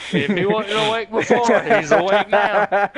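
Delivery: cracking up while delivering it; laughing quip. Retold or imitated. Joking to friends about the roaring truck, laughing.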